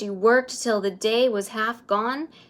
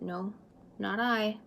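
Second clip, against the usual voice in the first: soft refusal; 7 dB quieter than usual. A narrator's reading. Rosie refuses herself.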